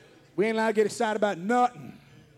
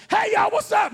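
mock loud greeting; 11 semitones higher, 5 dB louder than usual. Loud greeting in dentist office.

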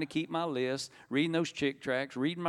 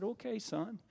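soft, higher-pitched motherly reply; tender assent; 8 semitones higher, 8 dB quieter, slower than usual. Retold or imitated. Mama forgiving her pleading son, tender assent. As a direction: give in gently, voice small and high.

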